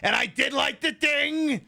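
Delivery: high, slow, drawn-out Trump voice; gravelly Trump part. Shown as a demonstration. Streamer demos the part of Trump's voice he can't nail, slow and high. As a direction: stretch it out the way Trump does. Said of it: "where he goes like the".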